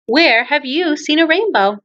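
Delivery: ordinary talk, nothing marked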